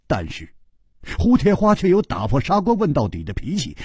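ordinary delivery, nothing marked

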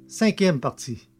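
plain reading voice

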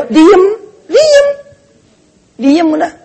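high, short command to hush. Acted. Preacher tells wives to hush.

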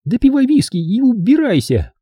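high woman's voice, crossly dismissing him; indignant order. Acted. Hilda throws the vulgar guest out, high indignant order.

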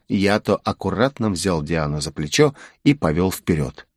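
ordinary delivery, nothing marked

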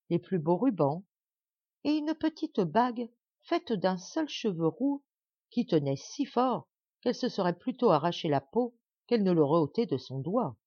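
plain reading voice

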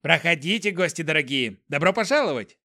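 beaming servant, lightly voiced; pixie. A narrator's reading. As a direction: gush a welcome. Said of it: "слуга лучился радостной улыбкой".

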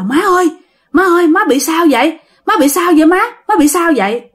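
high-pitched, urgent calls to mother; startled alarm. Acted. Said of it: "giật mình hết hồn".

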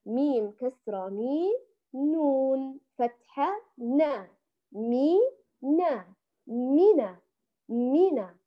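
letters named slowly, then مينا said firmly twice; slow spelling out. Shown as a demonstration. Teacher drills the word with her students, slow spelling. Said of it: "إذن".

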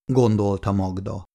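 usual speaking voice

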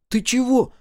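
high-pitched alarmed question; frightened child. A narrator's reading. Микки sees sister freeze, frightened. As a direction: ask in fright as a small boy. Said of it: "испуганно спросил Микки".

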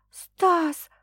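high whining cry of his name; whimpering girl. A narrator's reading. Trembling girl crying out to Stas, high. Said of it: "захныкала Саша".

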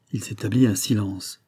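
plain reading voice

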